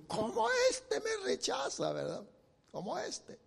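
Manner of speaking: very high, soft indignant woman's voice; offended woman. Acted. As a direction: play the spurned wife, squeaky and hushed. Acts offended wife complaining. (voz de mujer ofendida, muy aguda y baja de volumen)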